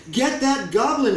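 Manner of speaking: loud high slow chase shout; loud angry mob shout. A narrator's reading. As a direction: yell it as a mob. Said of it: "shouted everyone".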